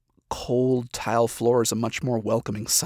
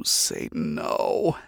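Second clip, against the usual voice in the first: horrified whisper; 7 semitones higher, 3 dB quieter, much slower than usual. Acted. Horrified muttered aside.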